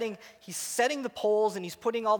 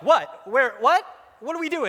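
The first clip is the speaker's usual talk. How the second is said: quick-fire, loud startled questions; mock panic, firing off bewildered questions; flustered confusion; 5 semitones higher, 7 dB louder than usual. Flustered reaction to the yell.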